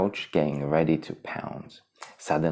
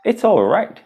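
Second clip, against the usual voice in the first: high, gentle reassurance; calm your friends; reassuring; 7 dB louder than usual. Acted. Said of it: "he said, with a wave of his paw".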